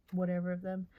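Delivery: normal talking voice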